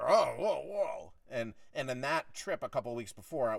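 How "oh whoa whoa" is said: raised, loud 'whoa' in imitation; startled protest, played for the story; mock taken aback. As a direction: imitate someone backing off in alarm. Imitates boss taken aback by retort.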